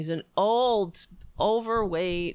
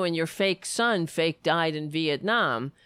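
ordinary delivery

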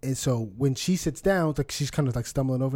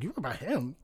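mocking quiet jab; 10 dB quieter than usual. Teasing the woman about her husband, softly. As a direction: drop it soft and needle him. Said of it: "I was like".

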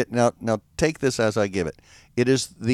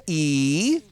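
Baltimore vowel demo; 5 dB quieter than usual. Shown as a demonstration. Comedian teaching the hosts Balmerese vowels, Baltimore accent. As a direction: draw out the Baltimore "E". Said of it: "the Balmerese vowels".

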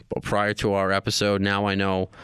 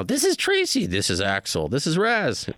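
mock character voices; 6 semitones higher than usual. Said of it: "do you do voices".

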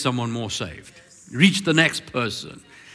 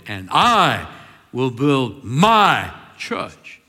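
very slow, each word weighted, stress on my; emphatic; 4 semitones lower, much slower than usual. Retold or imitated. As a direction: let I will build my church land like a decree. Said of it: "He was quite emphatic".

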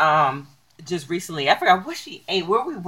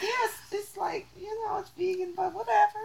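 dismissive; 13 semitones higher, 14 dB quieter than usual. Retold or imitated. Answering a doubter, soft dismissive shrug. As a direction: brush it off, half-caring. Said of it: "I'm like".

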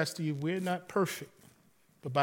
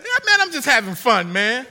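higher and louder, chuckling and carefree; laughing reply; 7 semitones higher, 11 dB louder than usual. Retold or imitated. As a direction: shrug it off with a laugh. Pastor's retold reply, laughing.

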